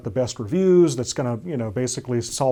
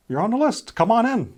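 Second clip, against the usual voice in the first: casual officer voice; 10 semitones higher, 4 dB louder than usual. Retold or imitated. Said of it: "officer was like".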